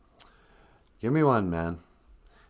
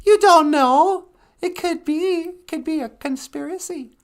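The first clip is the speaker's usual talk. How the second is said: insistent high-voiced sidekick; 6 dB louder than usual. Sidekick defending Aquaman theory to host, insistent high voice. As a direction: protest loudly in a tiny high voice.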